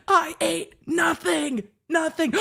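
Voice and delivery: high, slow mock shout of I ate nothing; goat-scream imitation. Reenacting the hungry girl's scream for chat, high mock shout. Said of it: "Swoop with a goat scream at the end".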